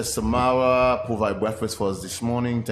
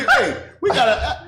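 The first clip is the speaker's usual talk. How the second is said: laughing; 13 semitones higher, 3 dB louder, slower than usual. Real, not acted.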